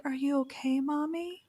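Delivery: imitating a worried little child, high-pitched; Abby. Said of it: "And Abby's like".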